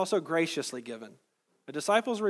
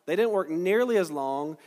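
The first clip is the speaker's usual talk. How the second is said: normal talking voice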